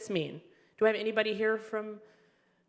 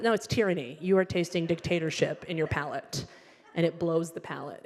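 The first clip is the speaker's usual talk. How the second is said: ordinary talk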